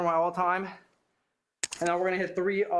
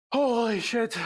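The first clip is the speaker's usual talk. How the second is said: higher astonished exclamation; stunned; 6 semitones higher, slower than usual. Real, not acted. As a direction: blurt it out in disbelief. Reacting to his own PR.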